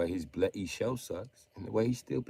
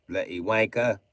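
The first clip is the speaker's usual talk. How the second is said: ordinary talk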